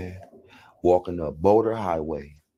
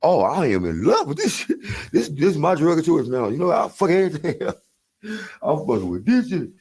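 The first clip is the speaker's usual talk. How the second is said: much higher, laughing rapture; ecstatic declaration; 11 semitones higher than usual. Retold or imitated. First high with the girls, ecstatic laughing high voice. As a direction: gush about the new drug, high. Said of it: "I'm like".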